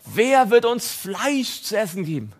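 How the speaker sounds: slow, high whiny lament; mock weeping. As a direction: whine like a craving crowd. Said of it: "immer wieder zu weinen".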